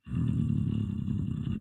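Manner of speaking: low, quiet throaty growl on the breath; low growly hum. Retold or imitated. Man standing silently among strangers, low growly hum. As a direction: growl softly as you breathe. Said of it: "Did that motherfucker growling?".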